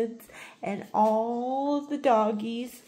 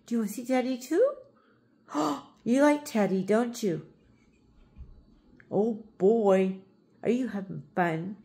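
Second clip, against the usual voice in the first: ordinary delivery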